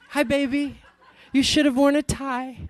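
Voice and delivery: high, laughing motherly voice; a proud mother needling her son about his clothes; teasing mom. Retold or imitated. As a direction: chuckle through a doting scold.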